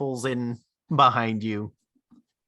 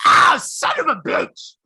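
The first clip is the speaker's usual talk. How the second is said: high yelling curse after a punch; pained shout; 14 semitones higher, 8 dB louder than usual. Acted. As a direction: yell it out like you just got hit. GM voices punched werewolf.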